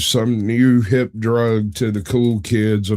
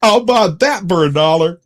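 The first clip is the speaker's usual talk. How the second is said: very high, loud rapid-fire yeahs into the line; movie catchphrase impression; 13 semitones higher, 5 dB louder than usual. Podcaster quoting a film for the co-host, hammed-up impression. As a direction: belt out a goofy high-pitched quote. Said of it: "The quote is".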